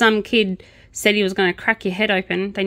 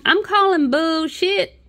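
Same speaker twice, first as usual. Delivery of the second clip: higher, slow Southern American twang; American Southern accent; 10 semitones higher, slower than usual. Retold or imitated. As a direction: drawl it like a Southern American judge. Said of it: "the judge was suddenly American".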